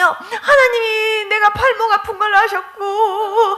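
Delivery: very high, drawn-out mock wail; imitated weeping. Retelling a member moved that God knew her pain, wailing. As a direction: act out sobbing words, stretching the last one. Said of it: "울으시는 거예요".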